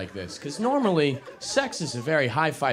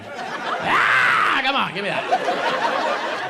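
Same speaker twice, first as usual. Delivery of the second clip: pleading with audience; 14 semitones higher, 6 dB louder, much slower than usual. Real, not acted. Begging audience member to play along.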